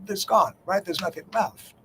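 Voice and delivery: ordinary talk, nothing marked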